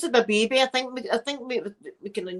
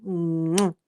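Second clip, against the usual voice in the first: big kiss; 2 semitones lower than usual. Real, not acted. Streamer signing off to viewers, big kiss. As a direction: blow an audible kiss to everyone. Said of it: "give yous all a big kissy".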